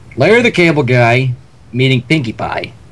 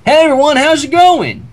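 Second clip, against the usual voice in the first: cheery Larry greeting; 10 semitones higher than usual. Acted. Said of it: "He smiled and waved".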